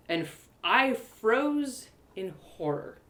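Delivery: plain reading voice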